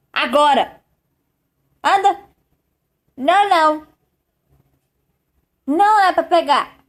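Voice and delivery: loud, firm forbidding orders; scolding. Real, not acted. (bronca firme, proibindo em voz alta)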